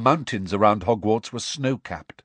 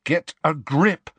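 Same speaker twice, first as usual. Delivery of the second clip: slow, higher-pitched scolding; stern; 7 semitones higher, 3 dB louder than usual. A narrator's reading. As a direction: sternly pull a friend together. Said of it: "said Harry sternly".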